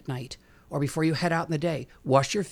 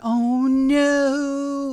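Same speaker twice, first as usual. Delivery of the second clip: dismayed oh no; 10 semitones higher, 7 dB louder than usual. Retold or imitated. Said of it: "And I went".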